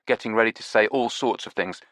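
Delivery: ordinary delivery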